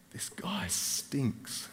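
slow, breathless whisper to himself; inner thought whispered. Retold or imitated. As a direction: whisper it as a private disgusted thought. Private thought about smelly man.

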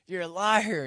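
loud, high accusation; accusing shout. Retold or imitated. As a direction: fling the accusation at him. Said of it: "Jesus calls Paul a liar".

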